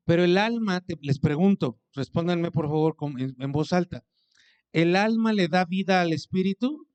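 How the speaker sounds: ordinary delivery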